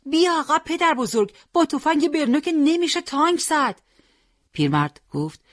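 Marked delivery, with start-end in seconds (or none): 0.1-3.8 high, urgent call to pull back; alarmed shout, pitched way up. A narrator's reading. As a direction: yell a frantic warning at the old man. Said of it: "ناصر داد زد".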